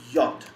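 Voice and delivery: yacht said as "yot" with a clear y sound; name the letter J as yacht, "yott"; yacht opening on a y glide; German letter name. Shown as a demonstration. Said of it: "that Y sound".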